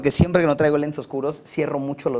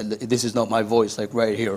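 lightly put-on lower, slower voice; not-my-voice demo; 5 semitones lower, slower than usual. Shown as a demonstration. Singer showing the deep voice he does not have, lightly lowered demo. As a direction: slip into a slightly deeper English voice. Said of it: "no tengo una voz negra, negra".

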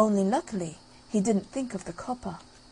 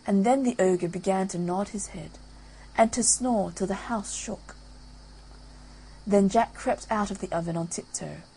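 ordinary delivery

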